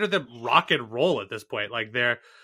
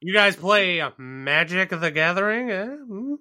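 nerd voice; 4 semitones higher, slower than usual. Acted. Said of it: "whole podcast in this nerd voice".

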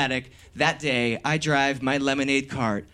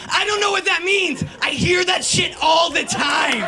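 Steve's outburst; 13 semitones higher than usual. Retold or imitated. Steve yelling about the name he keeps hearing. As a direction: shout it, baffled and annoyed.

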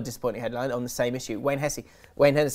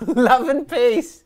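laughing; 10 semitones higher, 7 dB louder, much slower than usual. Retold or imitated. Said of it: "laughing mock repeat".

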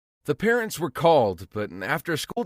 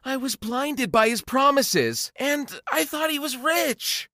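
high-pitched, pleading apology; pleading girlfriend; 10 semitones higher than usual. Acted. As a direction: beg forgiveness in a high girlish voice. Ex-girlfriend begs him back.

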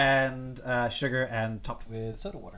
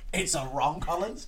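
cheesy pun voice; 4 semitones higher than usual. Retold or imitated. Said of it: "stoop as low to be like".

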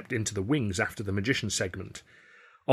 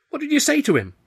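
very high, louder urgent question; 6 dB louder than usual. Acted. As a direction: press her for the answer. Urgent demand, very high loud.

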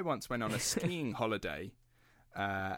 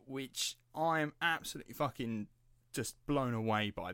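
ordinary talk

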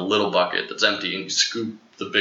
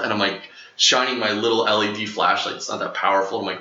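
ordinary delivery

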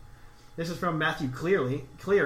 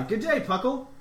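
higher, lightly put-on Aussie greeting; Australian accent; 5 semitones higher than usual. Host reading an Australian listener's letter, Australian accent. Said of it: "He's Australian".

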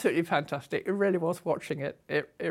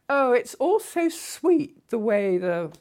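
gushing lady; 7 semitones higher, 5 dB louder, slower than usual. Retold or imitated. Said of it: "and she said".

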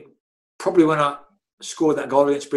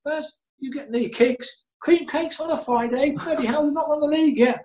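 mimicked boss voice; 12 semitones higher than usual. Chairman mocked over cakes before winning the league, very high voice. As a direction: squeak it high like a fussy chairman. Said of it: "it was the same backdrop".

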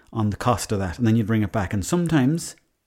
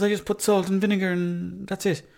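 put-on high Italian shopkeeper accent; 9 semitones higher than usual. Italian fella defending his chips to a customer, Italian accent. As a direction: explain it in a raised-pitch Italian accent. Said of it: "So the Italian fella goes to me".

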